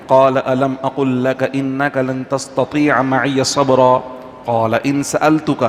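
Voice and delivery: slow, drawn-out Quranic recitation. Retold or imitated. Imam reciting the verse in taraweeh, slow melodic recitation. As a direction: chant each word slowly as in prayer.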